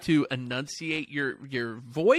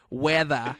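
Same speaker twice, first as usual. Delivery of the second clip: British attempt sliding into Australian; accidental Australian accent; 3 semitones lower than usual. American trying British for Welsh friend, slipping into Australian. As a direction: say weather with an Australian open ending. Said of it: "you just went full Australian".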